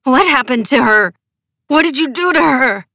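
sharp as broken glass, demanding answers; frantic accusing cry; shrill demand. A narrator's reading. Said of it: "Her voice was shrill, sharp as broken glass".

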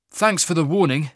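a bold traveller hailing a wagon master; Cole's voice. Acted. Narrator voicing Cole hailing the teamster, brisk and loud.

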